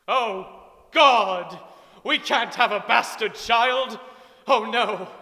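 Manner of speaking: loud, high wail of dismay; hammy screaming panic over an illegitimate child; hammed-up outburst. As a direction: melodramatic shout of horror. Dramatic outburst about bastard child.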